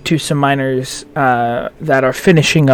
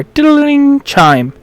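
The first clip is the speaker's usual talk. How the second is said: sing-song chime; 9 semitones higher, slower than usual. Acted. GM imitates door bell.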